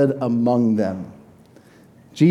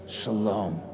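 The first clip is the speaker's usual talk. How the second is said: soft, slow, lowered shalom; gentle shalom; 5 semitones lower, 11 dB quieter than usual. Shown as a demonstration. Preacher has everybody say shalom this morning, softly and slowly. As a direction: breathe the greeting out gently and unhurried. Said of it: "Everybody say shalom this morning".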